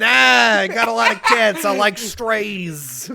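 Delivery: loud, high Brooklyn caricature; Catwoman, Brooklyn accent. Acted. Introducing herself in the bit, loud Brooklyn accent. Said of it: "Hey, I'm Catwoman".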